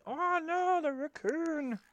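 very high, loud gasp of alarm; mock alarm. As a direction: squeal it in mock panic.

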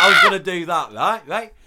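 ordinary talk

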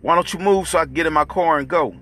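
loud, high, exasperated demand; impatient shout. Acted. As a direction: shout at them to move. Impatient drunk cop yelling.

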